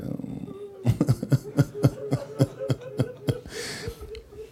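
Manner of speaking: high-pitched, long burst of laughter. Real, not acted. Speaker overcome after praising God, high laughter.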